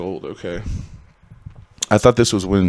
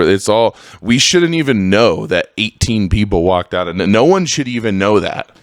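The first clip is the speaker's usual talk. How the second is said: ordinary talk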